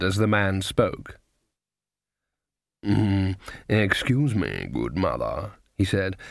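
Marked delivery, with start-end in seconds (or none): 2.8-5.5 slow, low voice of a card sharper; oily-polite stranger approaching an old woman; smooth card sharper. A narrator's reading. Card sharper greets a lone old woman, smooth.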